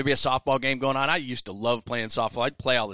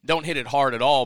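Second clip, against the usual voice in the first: ordinary talk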